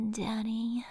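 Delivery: creepy whispered word; whispering. Pretend.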